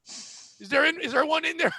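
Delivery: ordinary talk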